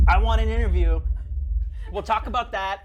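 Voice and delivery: loud demand. Acted. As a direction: belt it out like a demand. Loudly demanding an interview.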